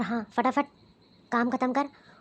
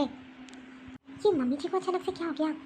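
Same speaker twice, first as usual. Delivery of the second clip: puzzled daughter-in-law; 3 semitones higher than usual. Acted. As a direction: wonder aloud why she is scolded.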